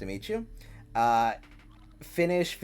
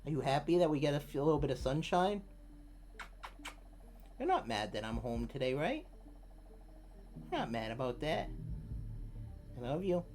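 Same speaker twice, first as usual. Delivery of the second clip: cooing to cat; 9 dB quieter than usual. Real, not acted. Streamer picking up her sleepy cat, soft cooing. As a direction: coo over a sleepy kitten.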